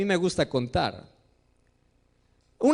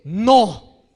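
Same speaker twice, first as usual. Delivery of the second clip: a short, louder flat refusal; firm no; 2 semitones lower, 5 dB louder than usual. Retold or imitated. Father firmly refusing the whining child, louder. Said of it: "si el Padre es firme".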